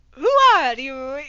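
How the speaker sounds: a loud hammed-up cry; mock scream. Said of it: "we all screamed".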